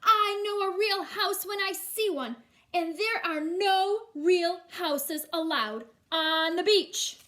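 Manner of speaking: loud high lecturing judge; stern judge. Acted. Judge telling Matthew the rules, loud and stern. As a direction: lay down the law.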